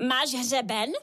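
a hint of a witch grown gentler; softened witch. A narrator's reading. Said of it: "hlas nejméně o tóninu jemnější".

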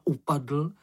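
ordinary delivery, nothing marked

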